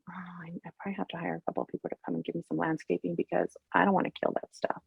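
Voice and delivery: rushed, breathless whisper of a private fear; whispered worry. Retold or imitated. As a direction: whisper it fast to yourself. Worried thought about killing plants.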